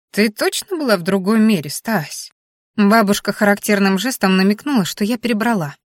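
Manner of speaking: ordinary delivery, nothing marked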